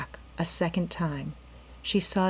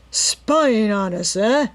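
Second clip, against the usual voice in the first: slow, louder menacing snarl; 3 semitones higher, 7 dB louder, slower than usual. A narrator's reading. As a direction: drag it out like a threat. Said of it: "he snarled".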